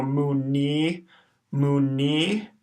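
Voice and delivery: slow, syllable by syllable mu ni; syllables mu ni spaced out; syllable demo. Shown as a demonstration. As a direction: sound out mu ni slowly, each syllable apart. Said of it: "Then we see a long I".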